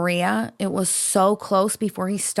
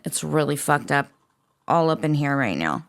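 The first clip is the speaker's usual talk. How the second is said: normal talking voice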